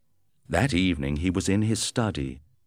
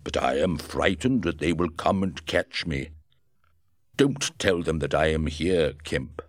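a touch of frightened Griffin; 2 semitones lower than usual. Acted. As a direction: beg him not to tell. Frightened Griffin begs secrecy.

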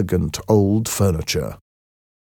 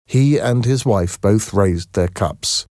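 ordinary delivery, nothing marked

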